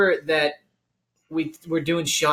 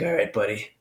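menacing bouncer, pitched lower; lower tough-guy voice; 9 semitones lower than usual. Retold or imitated. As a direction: lean in like a bouncer. Bodyguard threatening in story.

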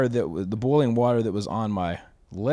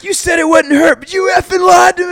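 replayed scream, very high and loud; hammed-up high furious yell, retold; screaming at the top of lungs; 15 dB louder than usual. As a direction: shriek the accusation at the doctor. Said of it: "screaming at the top of my lungs".

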